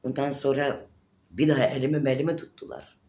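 ordinary talk, nothing marked

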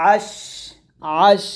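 আশ with a prolonged airy শ; breath release. Shown as a demonstration. Teacher showing students hams, আশ with released breath. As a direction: let the air flow out on the শ of আশ. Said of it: "এরকম বাতাস বের করবেন".

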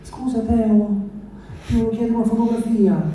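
lightly voiced pleading girl; girl's voice.

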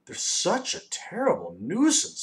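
slow, high, exasperated lament; sighing. A narrator's reading. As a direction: sigh it out wearily, drawn out. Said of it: "sighed the math magician".

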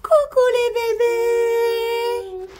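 high, slow cooing greeting. Real, not acted. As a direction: coo it to the babies. Greeting baby animals, sing-song.

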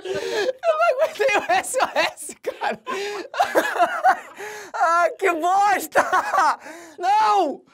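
high squealing laughter; can't stop laughing; laughing hard. Real, not acted. Cracking up at the joke.

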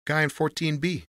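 loose-tongued porter, pitched well up. A narrator's reading. Porter shows Tony the dollar bill, loosely. Porter answers under Tony's questioning.

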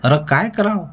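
high-pitched, irritated acted question; snapping interruption. A narrator's reading. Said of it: "Patil मध्येच खेकसला".

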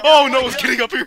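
high, very loud, fast yell; panicked shout. Real, not acted. Player spots zombie climbing, panicked shout. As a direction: yell the alarm in a rush.

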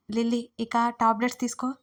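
usual speaking voice

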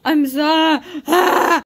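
overwhelmed crying shout; loud tearful cry. Real, not acted. Overwhelmed outburst at dad's party.

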